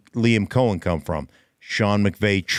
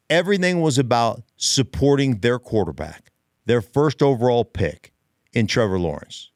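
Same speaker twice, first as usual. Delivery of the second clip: ordinary talk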